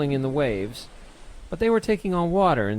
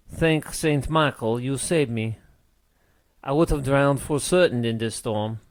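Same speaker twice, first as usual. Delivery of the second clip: put-on French-accented sailor telling his tale. A narrator's reading. Rescued sailor explains the British attack, French accent. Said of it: "begged in a French accent".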